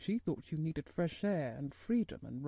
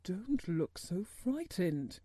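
slow, mildly exclaimed reassurance; slower than usual. A narrator's reading. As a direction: exclaim it gently, drawing the words out. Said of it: "he exclaimed".

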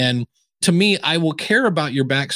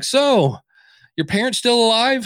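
nosy interviewer; 8 semitones higher than usual. Retold or imitated.

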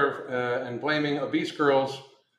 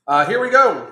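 excited; 5 semitones higher, 9 dB louder than usual. Real, not acted. Launching into next topic.

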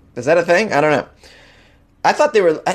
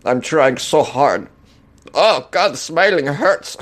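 slow, pained words through a forced grin; strained fake smile; much slower than usual. Host imitating a Russian forcing a smile, strained. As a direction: talk like your face hurts from smiling. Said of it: "this is my impression of Russian smile".

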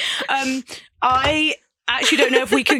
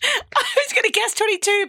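high pitched; 7 semitones higher than usual. Real, not acted. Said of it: "That was so high pitched".

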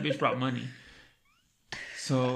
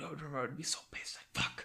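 hushed angry; 8 dB quieter than usual. Retold or imitated. Retold anger, recalled swearing.

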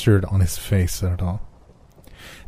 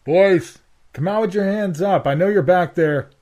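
high booming cop voice; megaphone voice; 11 semitones higher than usual. A narrator's reading. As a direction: call through a bullhorn. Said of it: "a gravelly voice boomed over a megaphone".

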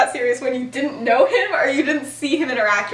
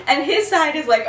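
normal talking voice

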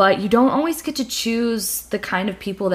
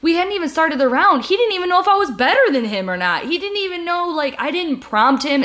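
indignant; 8 semitones higher, faster than usual. Real, not acted. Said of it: "outrage retelling".

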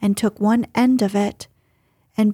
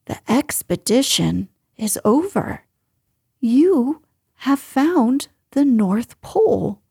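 solemn announcement with weighty pauses; measured, ceremonious pronouncement; 2 semitones higher than usual. A narrator's reading. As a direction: declare it gravely, lifting on the key words. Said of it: "said Christopher Robin solemnly".

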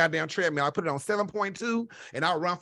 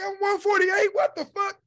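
high, amazed squeal over the weight; shocked squeal; 11 semitones higher than usual. Retold or imitated. Stunned by the scale, high amazed squeal. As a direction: squeal it in happy disbelief. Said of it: "I'm like".

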